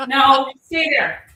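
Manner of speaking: high, loud, slow order to the dog; firm dog command. Real, not acted. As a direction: tell the dog to stay, slowly and firmly. Firmly ordering dog to stay.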